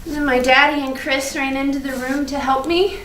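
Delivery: ordinary talk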